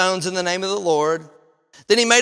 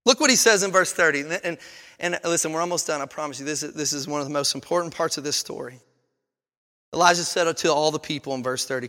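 normal talking voice

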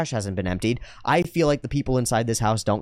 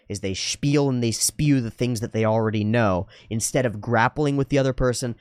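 ordinary delivery

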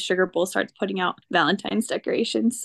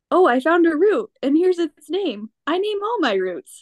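put-on higher, earnest kid voice showing off a find; proud cartoon kid; 7 semitones higher, 3 dB louder than usual. Binky showing friends his roots, higher proud kid voice. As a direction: proudly present your root collection. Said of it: "and Binky is like".

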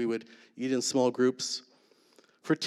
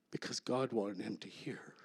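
whisper; 3 semitones lower, 11 dB quieter than usual. Real, not acted. Hushed climax of testimony.

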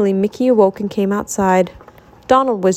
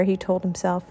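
usual speaking voice

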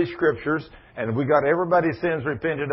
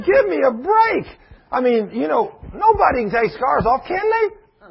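mocking doubter; 6 semitones higher than usual. Preacher mocks a doubting believer at prayer, high sarcastic scoff. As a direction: scoff like a half-hearted believer. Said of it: "You kneel down there and say".

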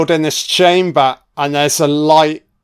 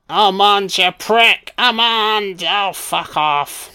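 lightly put-on, higher captive's voice; mock pleading call; 5 semitones higher, slower than usual. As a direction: call out in mock indignation, pitched up. Voices captive shouting at Armand.

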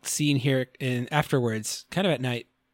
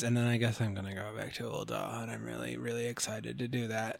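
reluctant; 5 semitones lower, 12 dB quieter than usual. Retold or imitated. Raban's reluctant quote.

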